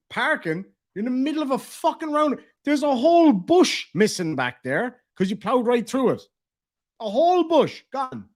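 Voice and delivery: mock outraged telling-off; incredulous. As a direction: reenact disbelief at her excuse. Retold incredulous telling-off of driver.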